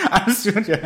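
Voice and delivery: laughing through the words; giggling it out. Real, not acted. Laughing at partner's reassurance.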